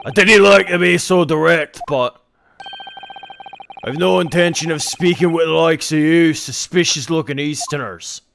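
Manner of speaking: put-on loud, contemptuous Scottish accent; hostile Scottish governor. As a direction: dismiss them loudly in Scottish. Voices the governor.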